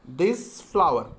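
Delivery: each word drawn out slowly for the class; very slow, clear dictation. Shown as a demonstration. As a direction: spell it out slowly so students can write. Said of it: "उसके बाद देखिएगा दूसरा sentence".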